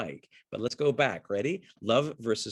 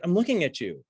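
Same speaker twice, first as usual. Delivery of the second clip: a mock-defiant actress, pitched up; the actress tosses it back at her acting partner, raised; imitating the actress; 8 semitones higher, 6 dB louder than usual. The actress insists to her partner she's looking, mock-defiant and higher. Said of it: "and she's like".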